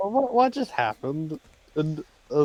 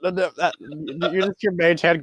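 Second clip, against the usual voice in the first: ordinary talk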